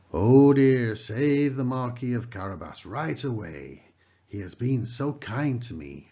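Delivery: alarmed loud oh dear; exclaiming. A narrator's reading. King exclaiming as the coach passes. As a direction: call out in alarm. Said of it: "exclaimed the king".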